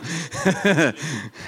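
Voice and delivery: short self-amused laugh. Real, not acted. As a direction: chuckle softly at yourself. Laughs at being biggest crier.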